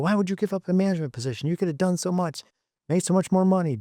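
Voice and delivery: high, fast disbelief at giving up management; incredulous young engineer. Retold or imitated. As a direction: ask it like you can't believe he'd step down. Young engineer questioning older manager.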